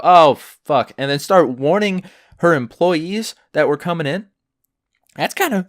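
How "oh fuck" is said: louder, exasperated groan of a curse; a manager spotting the muddy team walk in, loud dismay; mock dismayed curse. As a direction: blurt it like the manager sees trouble coming. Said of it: "just for the manager just to go".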